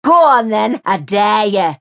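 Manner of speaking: loud, goading challenge; taunting dare. A narrator's reading. Mary daring the neighbour to call the police.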